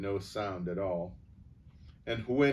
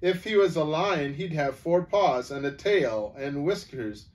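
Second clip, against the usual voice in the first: starting to laugh; 5 semitones higher, 6 dB louder than usual. A narrator's reading. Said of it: "and here Bree began to laugh".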